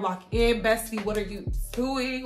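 normal talking voice

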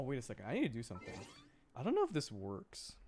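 ordinary talk

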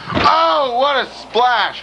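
high-pitched cry, drawn out; excited exclamation. Real, not acted. Commentator reacting to a splash in the match, excited exclamation. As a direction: exclaim high and let it stretch.

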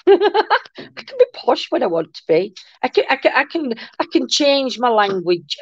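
hammed-up posh accent, pitched high; mock-posh, laughing at herself. Showing a viewer she can be posh, mock-posh voice. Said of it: "imagine me doing a posh voice".

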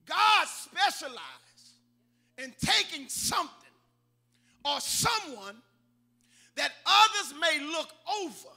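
loud, drawn-out preaching cadence; emphatic preaching. Real, not acted. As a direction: preach it slowly, punching each phrase. Preaches at full force.

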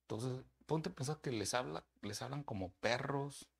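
normal talking voice

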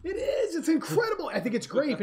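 dad hamming up delight at the lollipop; mock delight. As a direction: play up the wonder of a grape lollipop. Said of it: "And I'm like".

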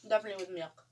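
chewing while talking; speaking between bites; mouth full. Real, not acted. Seen 1: Woman in yellow chews, holds biscuit near mouth while gesturing.

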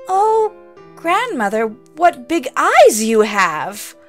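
put-on wide-eyed little girl marvelling; a touch of unease in a childlike voice; girlish wonder.

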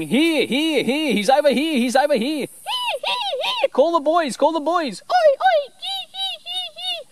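high squawking bird voice calling out; shrill alarm calls of Aussie miners mobbing a crow; mimicking miner birds. Mimicking Aussie miner birds alerting the flock, very high and loud. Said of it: "they're basically saying".

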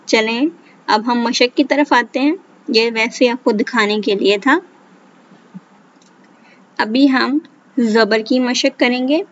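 ordinary delivery

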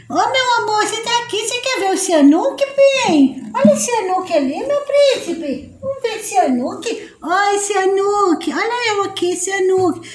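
high soft cooing voice for a little one. Real, not acted. Mother coos to her little son, soft high baby talk.

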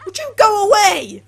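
high, loud telling-off; exasperated yell. Real, not acted. Gamer to an intruding character, exasperated yell. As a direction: shoo them off at full volume.

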